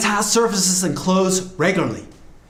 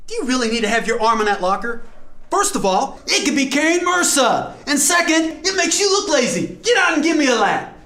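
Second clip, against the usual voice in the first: stern coach; 8 semitones higher than usual. Acted. Coach scolding a player.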